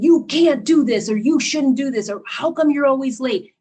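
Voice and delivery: imitating a controlling boss scolding; mock scolding yell. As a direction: nag and shame them, loud and sharp. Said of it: "And so they yell at them".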